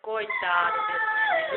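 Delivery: very high, squeaky pretend voice, slowing on "Coitado desse neném"; playful squeaky voice. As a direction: squeak it out like playing dolls with a child. Pretend play, mother voicing doll scene. (voz fininha de brincadeira)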